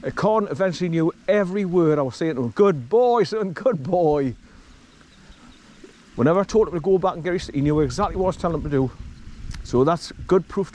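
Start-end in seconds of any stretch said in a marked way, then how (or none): 2.5-4.3 slow, drawn-out praise for a dog; praising the dog. Retold or imitated. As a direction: stretch the words out to reward him. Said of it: "This is how I taught Con".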